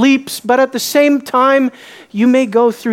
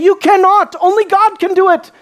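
scornful scribes; 9 semitones higher than usual. Acted. Scribes arguing with the disciples.

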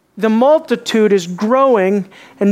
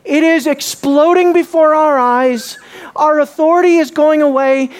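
higher, louder, alarmed cry of the leaders; mock alarmed leaders; 7 semitones higher, 4 dB louder than usual. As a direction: voice the leaders' rising panic. Voicing alarmed religious leaders.

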